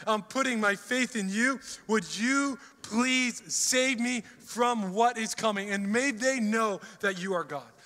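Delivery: earnest cry to God, building; pleading prayer. Retold or imitated. A king praying for rescue, pleading cry. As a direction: plead with God, slow and urgent. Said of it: "This cry to God".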